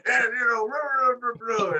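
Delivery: loud, high mock commentary babble; J R and Excalibur. Imitating announcers calling a match, loud mock babble. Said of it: "J R and Excalibur gonna be like".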